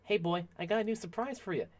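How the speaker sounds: high, quick, teasing call; excited gift-giver. Acted. Offering his dragon a new surprise, playful high voice. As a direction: pitch it up like calling a pet. Voicing someone presenting a new weapon.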